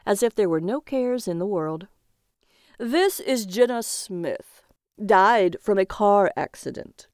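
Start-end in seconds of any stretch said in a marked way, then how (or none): 2.8-7.1 slow, projected auctioneer voice. A narrator's reading. Auctioneer introduces the dead woman, slow announcing voice. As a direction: announce the lot slowly and deliberately. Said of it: "the auctioneer said".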